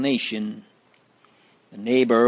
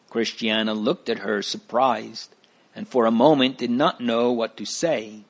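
usual speaking voice

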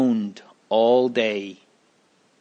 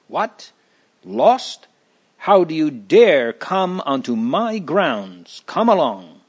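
angry giant; 5 semitones higher than usual. Acted. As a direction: lash out at the trespassers. Giant scolding trespassers.

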